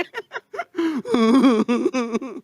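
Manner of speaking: high imitated weeping; hammed-up crying wife; mock sobbing. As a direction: run of fake hoo-hoo sobs. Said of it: "Nangis dia".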